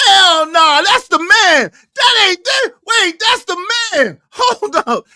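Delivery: loud, high-pitched shouting; excited yelling, voice way up; shocked recognition. Real, not acted. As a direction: holler it in total disbelief.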